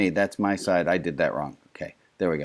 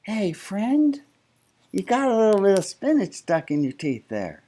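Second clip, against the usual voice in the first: friendly; 8 semitones higher than usual. Pretend. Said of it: "instead it'd be like".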